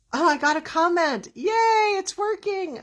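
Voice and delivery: high-pitched happy squeal; excited, pitch leaping way up; excited delight. Real, not acted. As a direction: cheer in real, sudden delight. Delighted by a first live comment.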